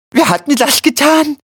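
high, pained wail of the wounded; wimmernd. A narrator's reading. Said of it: "wimmerte Quillipi". (hoch und gequält klagend)

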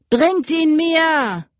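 the leader roars at his men; roaring order. A narrator's reading. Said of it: "brüllte der Mann".

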